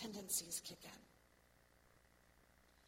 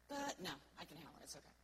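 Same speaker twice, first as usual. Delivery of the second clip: dismissive brush-off; 5 semitones lower, 8 dB quieter, much faster than usual. Acted. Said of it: "This is when we hear that".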